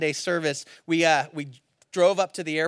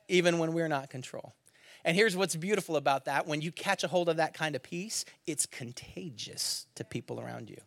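ordinary talk, nothing marked

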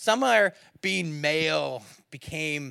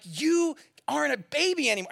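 frustrated scolding; 10 semitones higher than usual. Retold or imitated. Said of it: "And I'm getting frustrated".

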